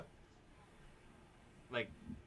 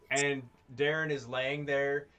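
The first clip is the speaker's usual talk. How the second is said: normal talking voice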